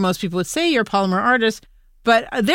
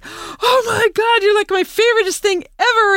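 excited dog voice; 12 semitones higher than usual. Retold or imitated. Dog greeting friends at the door, squealing high with joy. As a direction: gush like a dog overjoyed to see friends.

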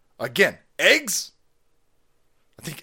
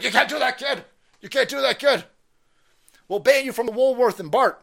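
higher, nagging security guard voice; mock scolding guard; 7 semitones higher, 5 dB louder than usual. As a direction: scold the kid like a fussy guard. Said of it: "you're like".

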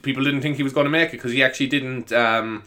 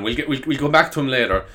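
ordinary delivery

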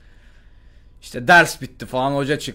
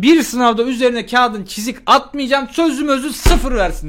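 high, loud staged outrage; fake anger; 9 semitones higher, 4 dB louder than usual. Student to principal, theatrically angry. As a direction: play up the indignation. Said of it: "fake sinirleniş".

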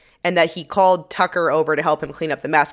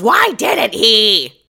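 slow, loud indignant demand; indignant shout; 5 semitones higher, 5 dB louder, much slower than usual. Retold or imitated. Olivia confronting Martin, slow loud outrage. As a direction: drag it out in outrage. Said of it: "And Olivia goes".

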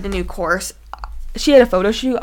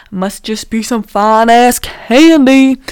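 drawn-out joke; 8 dB louder, slower than usual. Retold or imitated. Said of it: "And I'm like".

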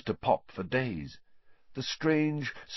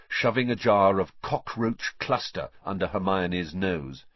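plain reading voice

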